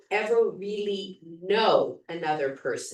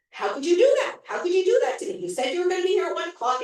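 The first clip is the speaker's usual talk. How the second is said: higher and louder, hurt demanding; upset reproach; 11 semitones higher, 4 dB louder, much faster than usual. Retold or imitated. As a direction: confront her for standing him up. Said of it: "he's very upset".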